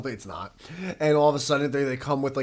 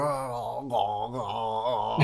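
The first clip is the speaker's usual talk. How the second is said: mock burning groans; 3 semitones lower, much slower than usual. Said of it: "your mouth was like".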